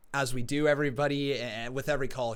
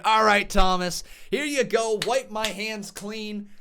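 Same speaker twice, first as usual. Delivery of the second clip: usual speaking voice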